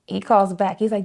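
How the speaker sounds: ordinary delivery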